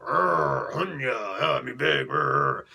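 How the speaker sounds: hammed-up monster grunts; dumb brute. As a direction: play a big dim brute. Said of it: "like that".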